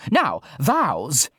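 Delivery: a grand eccentric aristocrat; posh voice. Acted. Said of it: "in her loudest posh person voice".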